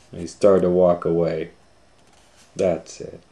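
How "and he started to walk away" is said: usual speaking voice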